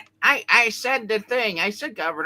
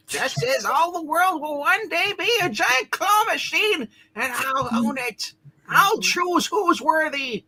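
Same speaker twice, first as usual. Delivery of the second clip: villain voice; 9 semitones higher than usual. Acted. Claw villain monologue.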